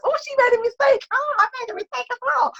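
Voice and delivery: high, put-on voice of relieved viewers; mock viewer realising it's okay to make mistakes, voiced high; imitating viewers. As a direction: voice the audience thinking aloud, light and high. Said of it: "and so they realise".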